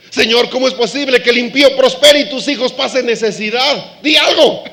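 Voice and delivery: shouting plea to the Lord; urgent complaint. Retold or imitated. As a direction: demand an answer from the Lord. David complaining to God.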